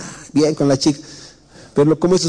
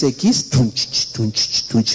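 cumbia beat imitation; 5 semitones lower, much slower than usual. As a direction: tap out the cumbia rhythm with your mouth. (imitación suave del ritmo "tunch, tunch")

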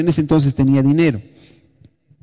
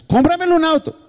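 put-on high, whiny teenage demand; whiny daughter; 12 semitones higher than usual. Pastor voices his daughter whining for a car, high and whiny. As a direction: beg like a spoiled teen.

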